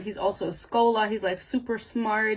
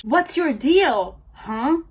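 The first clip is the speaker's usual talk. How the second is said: loud, drawn-out acted challenge; confrontational; 4 semitones higher, 5 dB louder, much slower than usual. Heroine confronting her partner in a book read aloud, confrontational. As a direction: demand an answer, higher and punchier.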